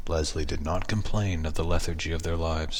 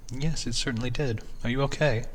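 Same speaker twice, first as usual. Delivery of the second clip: wife voice; 6 semitones higher than usual. Acted.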